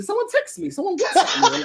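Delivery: high, laughing pretend alarm; laughing fake excuse. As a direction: squeal the excuse through laughter. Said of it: "start pretending like someone texts me".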